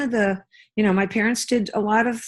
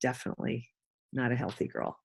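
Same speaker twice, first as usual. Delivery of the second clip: much softer, lower, sweet asking; gentle request; 4 semitones lower, 13 dB quieter, faster than usual. Retold or imitated. Wife gently asks husband for a card, much softer. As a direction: ask softly for what you want. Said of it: "I actually changed my tune".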